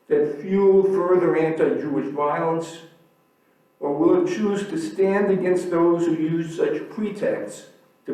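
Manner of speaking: reading a quote with rising force; formal reading aloud. Acted. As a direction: deliver it like a solemn public statement. Reads a quoted article aloud.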